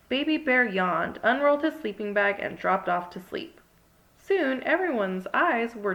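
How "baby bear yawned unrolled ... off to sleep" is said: ordinary delivery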